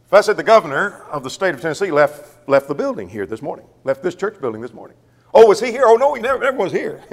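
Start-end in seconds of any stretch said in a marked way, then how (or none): 5.3-7.0 higher-pitched imagined exchange; mock question and answer. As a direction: play both sides lightly, pitched up. Acted imaginary exchange about the governor.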